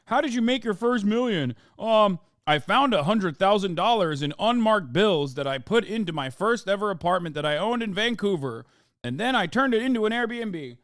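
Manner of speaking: mock the spoiled rich kid with a smug, chirpy voice; hammed-up trust-fund brat bragging. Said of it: "who are just like".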